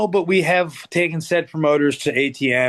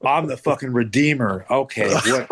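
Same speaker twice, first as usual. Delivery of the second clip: ordinary talk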